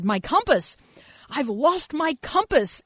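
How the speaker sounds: put-on a lost boy pleading over each word; desperate child. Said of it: "this measure of desperation in his voice".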